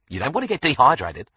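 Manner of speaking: high, loud bossy Aussie nurse; Australian nurse. A narrator's reading. Nurse pressing water on Alex, high and bossy. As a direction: insist brightly like a nurse.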